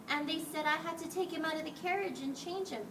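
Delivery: ordinary delivery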